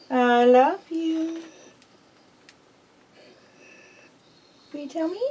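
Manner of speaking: high, coaxing sing-song pet voice; pet talk. Real, not acted. Owner coaxing her pet bird to say I love you, high coaxing voice. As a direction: sweetly coax the bird to speak.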